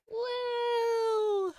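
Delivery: high, cheering whoop; excited whoop. Real, not acted. Host cheering her fixed audio, excited whoop. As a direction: celebrate the fix with a whoop.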